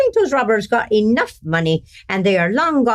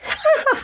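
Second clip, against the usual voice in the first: high laugh. Acted. [name] laughs at the idea.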